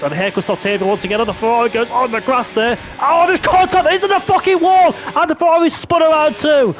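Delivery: high, loud crash call; shouting excitedly. Real, not acted. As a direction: yell the crash as it happens.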